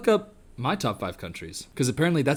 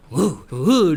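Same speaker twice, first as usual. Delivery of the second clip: mock dog "woo, woo"; imitated woo-woo howl; 5 dB louder than usual. Imitating the man in the collie suit, hooting woo. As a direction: hoot like a playful dog. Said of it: "He's sitting there going".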